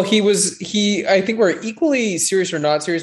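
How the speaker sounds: ordinary talk, nothing marked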